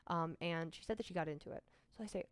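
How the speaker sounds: ordinary talk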